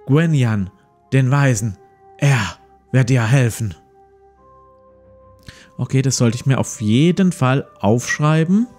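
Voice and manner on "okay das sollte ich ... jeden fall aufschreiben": ordinary talk, nothing marked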